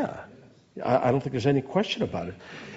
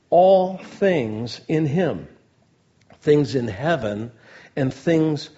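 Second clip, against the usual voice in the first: loud, slow, raised preaching voice; scripture reading; 3 semitones higher, 6 dB louder, slower than usual. Acted. Preacher reads Ephesians to the congregation, slow and emphatic. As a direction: proclaim the verse in deliberate, weighty phrases.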